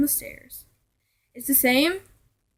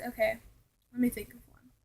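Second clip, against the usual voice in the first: whispered to herself; hushed aside to self; 8 dB quieter, faster than usual. Real, not acted. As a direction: breathe it under your breath. Whispered aside while recalling.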